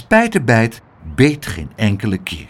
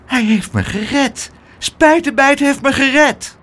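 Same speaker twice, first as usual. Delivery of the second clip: high, excited exclamation building up; pitched-up, thrilled relief; amazed joy; 12 semitones higher than usual. Acted. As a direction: exclaim it with growing wonder. Rescued child exclaims joyfully.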